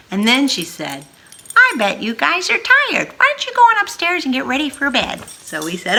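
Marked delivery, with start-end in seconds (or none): 1.6-5.2 high, louder kindly grandma. Acted. Grandma telling the tired kids to go upstairs, high and kind. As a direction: send them to bed sweetly.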